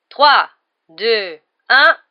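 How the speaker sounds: loud, spaced-out referee count; starting countdown. A narrator's reading. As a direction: call the count across the pitch. (compte à rebours lancé fort)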